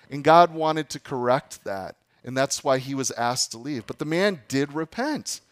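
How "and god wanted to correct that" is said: ordinary talk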